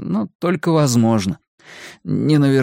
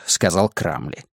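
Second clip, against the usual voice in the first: ordinary delivery, nothing marked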